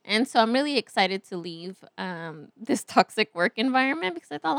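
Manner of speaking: ordinary talk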